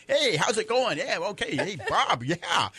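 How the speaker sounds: high, swooping hello to a coworker; breezy, chummy imitation with a chuckle; mock-cheery greeting. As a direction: greet him with exaggerated friendliness. Acts out cheery earlier morning greetings.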